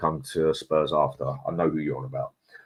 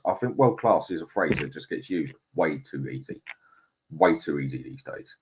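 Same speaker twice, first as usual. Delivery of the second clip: usual speaking voice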